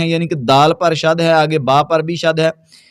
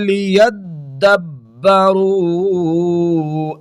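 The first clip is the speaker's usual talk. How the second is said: slow, drawn-out melodic tilawat; Quran recitation; 3 semitones higher, much slower than usual. Acted. Recites the word. (ترتیل کے ساتھ تلاوت)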